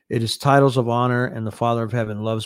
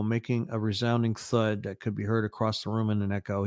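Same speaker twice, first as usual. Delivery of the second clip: ordinary delivery, nothing marked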